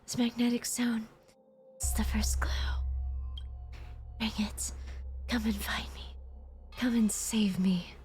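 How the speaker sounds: faint ghostly whisper, pleading; unearthly voice. Acted. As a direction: whisper like an echo from the dark. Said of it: "you hear a ghostly voice".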